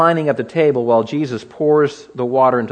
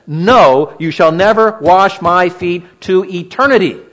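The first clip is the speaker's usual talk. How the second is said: louder, forceful Peter refusing outright; preacher voicing Peter's stubborn protest, louder and emphatic; emphatic refusal; 5 semitones higher, 7 dB louder, slower than usual. Peter refusing Jesus, emphatic and louder. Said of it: "That's pretty emphatic".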